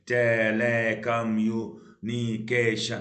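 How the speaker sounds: Telecommunication slow, every syllable drawn out. Shown as a demonstration. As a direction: intone telecommunication evenly, beat by beat. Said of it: "तो कई सारे हो गए".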